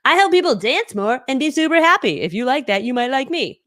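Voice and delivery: bouncy pitch, starting high and loud; big, cheerful promo delivery; upbeat tagline demo. Shown as a demonstration. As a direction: sell it with playful enthusiasm. Models a simple, upbeat tagline.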